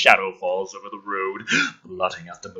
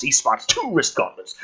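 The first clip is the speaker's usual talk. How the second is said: ordinary talk, nothing marked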